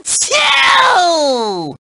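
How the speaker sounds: high, drawn-out explosive sneeze; huge sneeze. Acted. Host sneezes while thanking the player, huge sneeze. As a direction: blast out a giant cartoon achoo. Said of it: "I promise not to sneeze".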